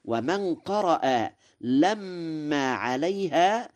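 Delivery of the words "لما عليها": لما as "lammaa" with a long held m; doubled meem. Shown as a demonstration. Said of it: "بالتشديد".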